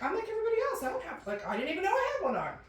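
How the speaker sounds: put-on high, disbelieving kid self; indignant kid. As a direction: protest like a shocked child. Child self quoted, indignant.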